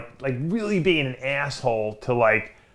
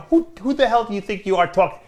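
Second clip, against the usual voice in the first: higher-pitched indignant challenge, retold; mildly heated, not shouted; retold aggravation; 7 semitones higher than usual. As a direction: act out squaring up to a stranger. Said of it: "I'm getting a little aggravated".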